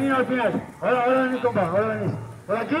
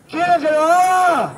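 higher, louder crowd call; hyping the crowd; 4 semitones higher, 5 dB louder than usual. Real, not acted. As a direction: rile up the audience.

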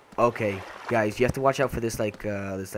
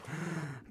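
sharp gasp of fright; startled gasp; 6 semitones higher, 12 dB quieter than usual. Real, not acted. Player gasps as the shark strikes. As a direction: suck in air in sudden panic.

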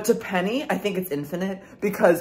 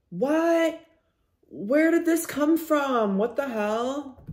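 high, slow puzzled disbelief; surprised; 8 semitones higher, slower than usual. Real, not acted. Vlogger baffled by something appearing, high surprise. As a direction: react like you can't believe it.

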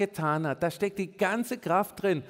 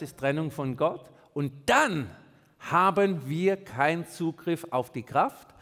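ordinary talk, nothing marked

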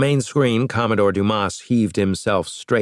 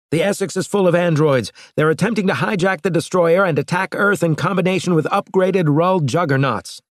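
higher, urgent explanation; urgent captain; 10 semitones higher, 3 dB louder than usual. A narrator's reading. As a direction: press the warning home, pitched up.

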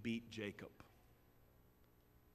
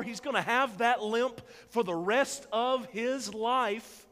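loud, rising preacher's build; preaching at full force; 10 semitones higher, 11 dB louder than usual. Acted. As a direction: drive it home to the congregation. Preacher's closing climax.

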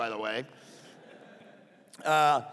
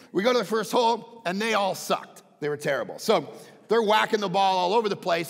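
ordinary talk, nothing marked

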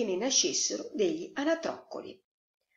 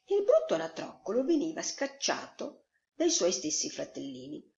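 ordinary delivery

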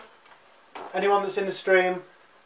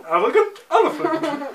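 higher, laughing through marshmallows; mouth full; 10 semitones higher, 4 dB louder than usual. Real, not acted.

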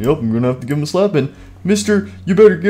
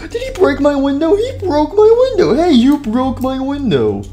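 much higher, shocked yelp; shocked shout; 10 semitones higher than usual. Acted. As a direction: shriek at the broken window. Discovers broken window, shocked.

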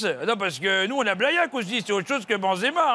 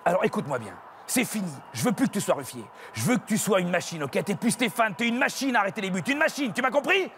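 stern coach; 4 semitones lower, 3 dB quieter than usual. Acted. Coach pep talk.

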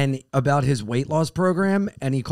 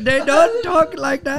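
mock Pakistani accent; 10 semitones higher, 4 dB louder than usual. Co-host correcting the question, joking high Pakistani accent. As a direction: answer laughing in a drawn-out Pakistani accent. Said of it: "Pakistani accent".

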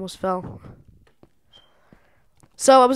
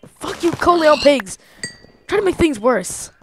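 angry curse; 6 semitones higher, 6 dB louder than usual. Real, not acted. Cursing the pigs.

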